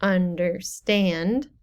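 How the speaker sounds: "Understand" slowly, with STAND stressed; two light syllables, then land on STAND in "Understand"; stress on last syllable. Shown as a demonstration. Teacher illustrates an anapest, last-syllable stress. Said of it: "two unstressed syllables and then stressed".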